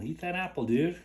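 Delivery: sing-song high voice coaxing the bird; high coaxing pet voice. Real, not acted. Talking to his pet bird about the apple, high coaxing voice.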